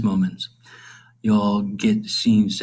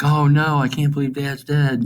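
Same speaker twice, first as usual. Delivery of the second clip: mock dismay; 6 semitones higher than usual. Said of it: "is not like".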